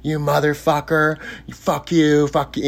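high-pitched screaming imitated without the volume; mock scream. Cook explodes at him in group therapy, toned-down mock screaming. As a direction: curse him in a held-back mock scream. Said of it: "He started screaming at me".

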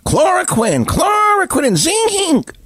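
high, slow, yelled parody of the slogan; shrieking cartoonish mockery of a TV pundit; mocking shouted clown. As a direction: scream the clown's catchphrase in ridicule. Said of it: "The television clown".

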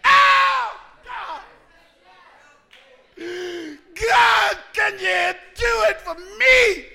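high, very loud anguished plea; desperate shouted prayer from someone hurting; cry of pain. Acted. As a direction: wail to God, stretching the words out. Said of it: "But when you hurt".

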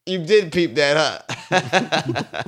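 laughing sheepishly at the officer; laughing while talking. Retold or imitated. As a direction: crack up as you admit you got caught. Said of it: "I'm crying to the motherfucker like".

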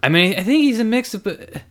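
high, loud flustered woman. Acted. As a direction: caught out, stumbling over words. Lizzie voiced, flustered.

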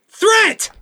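high, loud barked "Threat!"; sharp shouted command. Acted. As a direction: call the start like a range officer. Range officer starts the drill.

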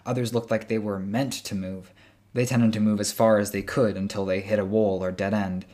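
usual speaking voice

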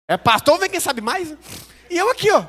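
high, loud and worked up; excitedly telling how the pastor outdid him. Real, not acted. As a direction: build the disbelief, voice climbing. Excited storytelling climax.

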